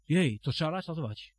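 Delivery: ordinary talk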